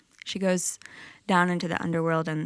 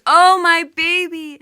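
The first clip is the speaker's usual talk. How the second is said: wailing cry; 11 semitones higher, 13 dB louder than usual. Acted. Goddess in the underworld wailing for her husband, loud keening wail. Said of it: "she's crying out".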